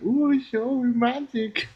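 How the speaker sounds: very high, slow squeaky wish; tiny awed voice; childish wonder. Pretend. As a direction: squeal like a wide-eyed kid. Wide-eyed request to creator.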